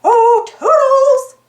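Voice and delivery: high, slow, loud sing-song call. Acted. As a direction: call the helper from afar. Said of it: "Mickey called".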